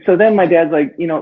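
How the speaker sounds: ordinary talk, nothing marked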